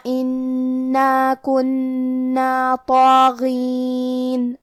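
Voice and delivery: very slow, level-toned tajweed chant; Quran recitation. Shown as a demonstration. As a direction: recite with long, measured elongations. (ٹھہر ٹھہر کر تجوید سے تلاوت)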